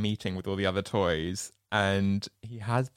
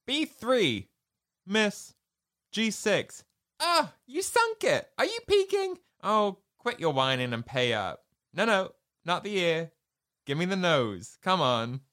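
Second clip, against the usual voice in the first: high mock toy voices; Potato Head and Pig; 9 semitones higher than usual. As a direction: act out a petty board game. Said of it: "And it goes".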